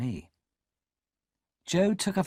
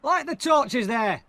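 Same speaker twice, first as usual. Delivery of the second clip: loud, high, slow command; shouted order; 11 semitones higher, 5 dB louder, slower than usual. Acted. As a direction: shout across to the soldiers. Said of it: "he shouted to the soldiers".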